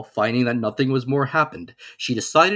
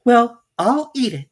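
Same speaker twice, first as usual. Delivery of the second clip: put-on much higher, louder, decided girl; Alice's voice; 13 semitones higher, 8 dB louder than usual. A narrator's reading. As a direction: bold little girl deciding. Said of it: "said Alice".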